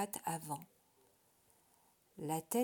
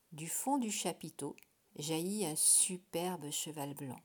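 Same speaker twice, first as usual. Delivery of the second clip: ordinary delivery, nothing marked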